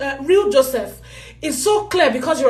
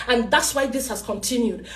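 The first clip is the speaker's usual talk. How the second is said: normal talking voice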